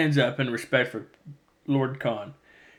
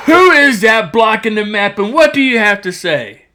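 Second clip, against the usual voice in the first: much higher and louder furious yell; angry outburst; 7 semitones higher, 11 dB louder than usual. Retold or imitated. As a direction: bark it out in fury at the intruder. Khan's map blocked by shadow.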